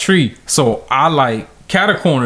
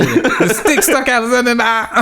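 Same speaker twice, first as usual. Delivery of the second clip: put-on scared kid's excuse; 7 semitones higher than usual. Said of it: "I said".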